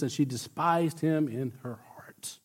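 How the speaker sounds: breathy, voiceless scripture whisper. Acted. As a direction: drop to a hushed whisper for her contempt.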